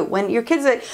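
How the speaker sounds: imitated kid's whining wail; mock childish whine; whiny wail.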